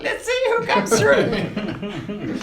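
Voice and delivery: high, slow goblin cackle of a voice. Shown as a demonstration. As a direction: squeal it slowly like a gloating little goblin. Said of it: "which is more like".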